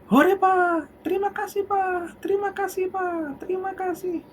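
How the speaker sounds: put-on high, gleeful child thanking dad; high child's happy thanks; excited child voice. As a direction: bubble over like a delighted little kid. Child thanking father joyfully.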